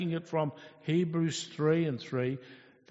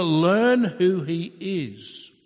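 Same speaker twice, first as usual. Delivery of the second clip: commanding God; 2 semitones higher, 7 dB louder than usual. Acted. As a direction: proclaim each name as a rebuke. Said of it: "God is saying to Peter".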